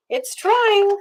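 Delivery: ordinary delivery, nothing marked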